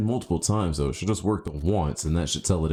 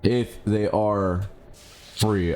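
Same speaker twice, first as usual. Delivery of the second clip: ordinary talk, nothing marked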